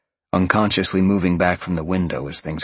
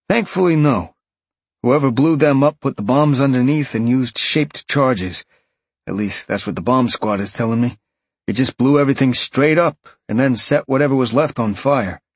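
higher, energetic, louder phone voice; brief him in a raised, emphatic voice; detective reporting; 7 semitones higher, 4 dB louder than usual. A narrator's reading. Detective briefing Sean on the explosion, emphatic.

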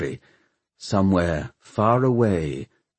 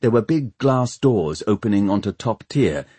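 ordinary delivery, nothing marked

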